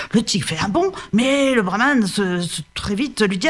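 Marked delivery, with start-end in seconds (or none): none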